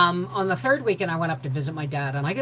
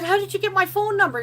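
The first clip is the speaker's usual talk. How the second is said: higher, astonished asking; amazed question. Retold or imitated. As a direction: ask in delighted disbelief. Amazed he found her.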